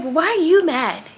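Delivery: high, loud, drawn-out challenge; indignant challenge. Retold or imitated. Customer retelling her comeback to the manager, indignant and loud. Retold confrontation with angry manager.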